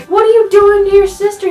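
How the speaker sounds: high, loud mom telling off her son; a startled mother yelling at her boy; scolding mom. Retold or imitated. Mom to the brother hitting his sister, high scolding shout.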